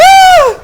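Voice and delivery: very high, very loud jolt of a scream; startled scream. Retold or imitated. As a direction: shriek as if books slammed down woke you. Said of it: "I screamed like a girl".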